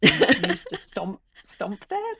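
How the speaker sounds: rising, puzzled "stomp there?"; puzzled husband question. Retold or imitated. Husband puzzled by a British phrase, rising in disbelief. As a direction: ask it baffled, voice jumping up. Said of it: "he's like".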